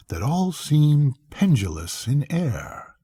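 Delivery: plain reading voice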